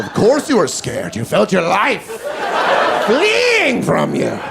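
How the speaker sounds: a lightly voiced, creepy slow laugh; a hint of a sinister robber taunting. Robber laughing creepily behind the victim. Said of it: "Then I hear him go".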